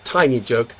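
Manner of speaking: usual speaking voice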